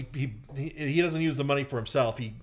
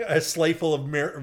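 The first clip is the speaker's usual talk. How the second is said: ordinary talk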